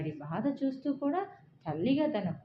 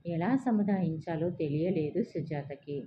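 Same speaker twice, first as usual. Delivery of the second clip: usual speaking voice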